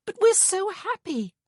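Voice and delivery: very high, loud squeal of glee. A narrator's reading. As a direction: squeal it with delight. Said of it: "Honor squealed".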